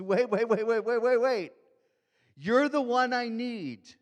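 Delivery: high, loud urgent cry of wait; a blind man shouting to stop Jesus passing; desperate calling out. Acted. Blind Bartimaeus shouting to Jesus, loud and desperate.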